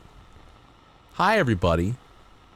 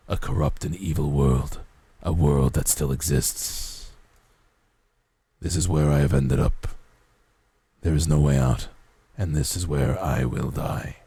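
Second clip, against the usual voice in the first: deep ancient undead king voice; the Lich; 7 semitones lower than usual. Retold or imitated. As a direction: drop low like a rasping lich. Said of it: "this sounds like, uh, the Lich from Adventure Time".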